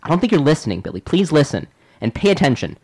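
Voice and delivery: put-on loud, strained pleading with a kid; exasperated pleading. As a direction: beg the child to listen, losing patience. Frustrated adult scolding child.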